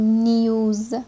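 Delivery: نیوز with an "ee-oo" glide after the n; non-American pronunciation. Shown as a demonstration. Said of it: "اینجا توجه کنیم که نمیگیم".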